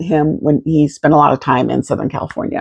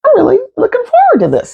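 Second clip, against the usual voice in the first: giddy excitement; faster than usual. Retold or imitated. Excited anticipation before the date.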